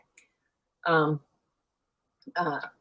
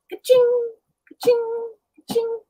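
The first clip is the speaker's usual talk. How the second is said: high imitated jingle of spurs; imitating spurs as the dog walks in; 3 dB louder than usual. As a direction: sing out the spurs' ka-ching. Imitates spur sound.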